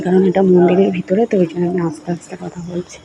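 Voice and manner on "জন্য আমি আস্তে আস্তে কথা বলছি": hushed voice inside the temple; speaking softly. Real, not acted. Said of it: "আমি খুব আস্তে আস্তে কথা বলছি". (আস্তে আস্তে নিচু গলায় কথা বলা)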